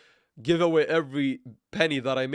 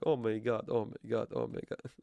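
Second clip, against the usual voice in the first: fading mock despair; 3 semitones lower, 6 dB quieter than usual.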